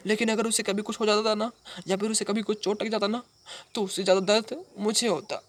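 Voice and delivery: high-pitched, aching confession; emotional outpouring. Acted. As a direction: pour it out in a high, pained voice. Emotional memory of his beloved. (ऊँची आवाज़ में भावुक इज़हार)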